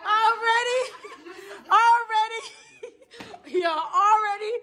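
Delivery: high, loud, laughing repeats; laughing disbelief. Real, not acted. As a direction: crack up in disbelief. Friend already in the pool.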